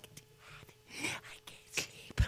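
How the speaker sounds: put-on sleepy child whispering dad can't sleep; hushed little voice at night; whispered plea. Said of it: "she'd come in and go like".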